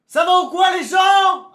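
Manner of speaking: crié aigu et fort, lent; salut crié. Real, not acted. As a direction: interpelle tout le chat en criant. Salue le chat en criant. (salue le chat en criant, aigu et fort)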